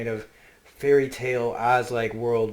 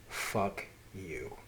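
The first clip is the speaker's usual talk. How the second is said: muttered curse; 4 semitones lower, 9 dB quieter than usual. Retold or imitated. Son mutters back at father.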